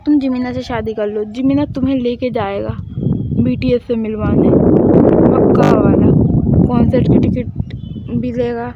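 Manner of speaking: loud, eager coaxing mother; eager pushy mother. Acted. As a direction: sell the marriage to her daughter. Mother eagerly pushes marriage. (बेटी को शादी के लिए ज़ोर से उत्साहित होकर मनाना)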